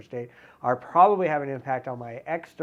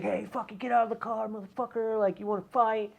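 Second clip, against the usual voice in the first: higher, put-on voice of a road-rage driver, a mild mock threat; aggressive driver; 7 semitones higher, 4 dB quieter than usual. Driver yelling from his car window at the narrator, a mild mock challenge. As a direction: sneer it like a hothead picking a fight. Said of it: "He's like".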